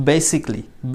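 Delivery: basically as "BAY-sik-lee"; three-syllable basically, the "ka" dropped; correct pronunciation. Shown as a demonstration. Teacher repeating for learners, basically as "bay-sik-lee". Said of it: "আসলে এটাকে উচ্চারণ করুন".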